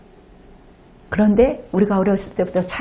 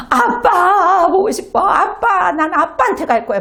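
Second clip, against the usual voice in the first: put-on whining crying child; 8 semitones higher than usual. Child scolded by mom crying for dad, high and sing-song. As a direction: wail like a little kid wanting dad.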